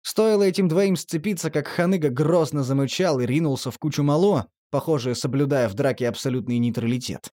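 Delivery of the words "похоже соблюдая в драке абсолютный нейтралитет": ordinary delivery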